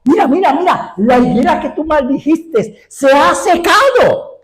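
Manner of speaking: high excited shout to the Master; Pedro shouting. Retold or imitated. Peter shouts to Jesus on seeing the withered fig tree, high excited cry. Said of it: "entonces grita así".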